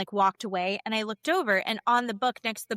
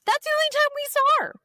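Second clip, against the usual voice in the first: excited squeal; 7 dB louder, much faster than usual. Real, not acted. Excited remark about Angie.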